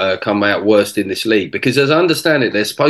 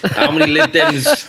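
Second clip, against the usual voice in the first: joke-telling comic. Retold or imitated. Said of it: "was gonna go on and go".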